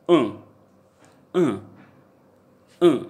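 "Uh" sounded through the nose; nasal vowel. Shown as a demonstration. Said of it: "sound should come out from your nose".

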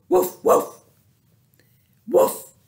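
high loud woof; barking dog. Acted. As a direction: bark like a happy dog. Dog barks.

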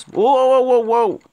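high, loud startled whoas; alarmed whoas. Real, not acted. Gamer spotting something sudden, alarmed whoas. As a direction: back off in alarm.

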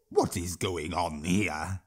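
low, slow troll voice; a sneering troll, taking his time; curious troll drawl, low and slow. Acted. Troll speaks to the boy.

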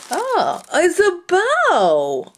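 high slow coo; delighted discovery. Real, not acted. Crafter finding a bow in her box, high delighted coo. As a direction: coo at the surprise.

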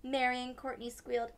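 usual speaking voice

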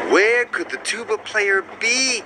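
high, worried little engine moan. A narrator's reading. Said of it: "Thomas moaned".

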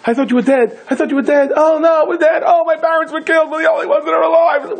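hammed-up wailing at finding each other alive; frantic grief. Brothers imagined meeting after the war, a high panicked wail. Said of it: "It was like".

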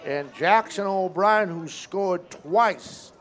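slow, drawn-out hitter introduction; announcer's introduction. Real, not acted. Announcing the next dangerous hitter.

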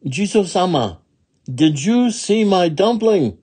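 high, urgent question; pleading little woman. Acted. Little woman to a statue about her dumpling, high pleading.

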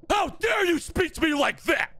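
high, shouted indignation; high-pitched yell of affront; outraged scolding. Acted. Outraged parent scolds child.

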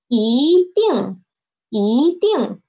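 一 rising, 定 falling sharply; second-tone yi. Shown as a demonstration. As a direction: demonstrate 一定 with 一 climbing to a peak before 定 drops. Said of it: "so it changed into second tone".

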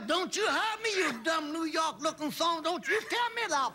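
high, hammed-up Southern army yell; mock the Southern sergeant barking at a recruit; Southern drill sergeant. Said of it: "I said".

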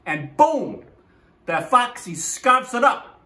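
usual speaking voice

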